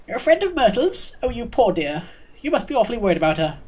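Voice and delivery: put-on high, swooping old lady voice; kindly old neighbour, pitying. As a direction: sympathise like a fussy old dear. Neighbour pities Myrtle's friend.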